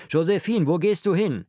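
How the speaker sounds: high, loud alarmed call; calling after someone. A narrator's reading. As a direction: shout after a daughter walking off. Father calls after departing daughter.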